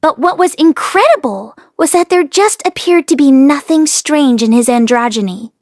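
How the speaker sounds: ordinary delivery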